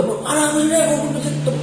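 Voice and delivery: high, loud alarmed call. Retold or imitated. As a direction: call through the door, startled. Mother bursts in, calling out. (seruan kaget, tinggi dan keras)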